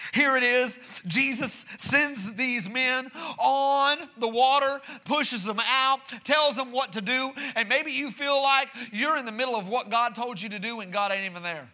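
preaching build. Acted. Pastor assuring the church God is not distant, preaching hard. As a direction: lift it up and preach it home.

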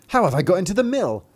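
high, loud, bewildered question; startled cry. Acted. Thumbling finds himself in the cow, crying out bewildered. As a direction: cry it out in alarm. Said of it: "cried he".

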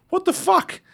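very high, stunned disbelief; mock outrage. To the driver who called him fat, shrill shocked. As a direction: squeak it out, caught off guard.

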